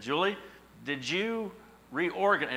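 slow, accusing "did you reorganize?"; suspicious question to wife. Retold or imitated. Husband suspiciously asking his wife about the box, drawn out. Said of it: "I said".

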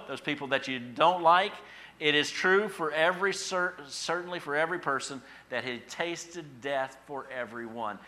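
loud, high, building pulpit list; impassioned preaching. Real, not acted. Preacher telling congregation none is left out, impassioned.

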